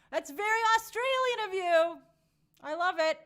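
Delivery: high, loud delighted exclaim; light up at the Aussie breakfast; cheerful high-pitched reaction. Real, not acted.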